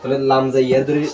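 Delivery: ordinary delivery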